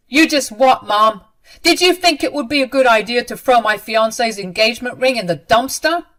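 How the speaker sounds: angry son confronting his mother; hard voice. A narrator's reading. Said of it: "talked to her in a hard voice".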